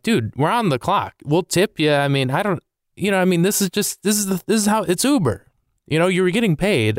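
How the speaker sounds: high-pitched, pleading argument; exasperated protest. Retold or imitated. Passenger arguing with a rude Uber driver, exasperated.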